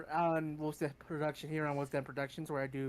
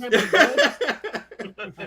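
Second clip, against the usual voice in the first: laughing; 6 semitones higher, 13 dB louder, much slower than usual. Real, not acted. Echoing the radioactive joke.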